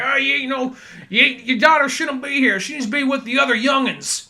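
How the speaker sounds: a hint of a crusty old prospector; lightly voiced cranky old miner scolding; old gold miner. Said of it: "John Stedman as Gator's dad, just the angry old, like, gold miner-esque".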